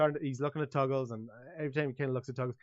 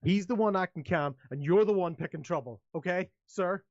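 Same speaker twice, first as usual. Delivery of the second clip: raised, higher guard voice; confrontational guard; 5 semitones higher, 5 dB louder than usual. Acted. Guard telling a troublemaker off, confrontational. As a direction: push back firmly as the guard.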